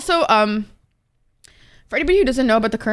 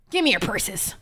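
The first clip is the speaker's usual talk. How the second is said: lower, put-on gruff stick-up voice; mock robber voice; 3 semitones lower, 5 dB quieter than usual. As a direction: growl it like a cartoon mugger, half-joking. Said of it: "Oh my God, that was cringe".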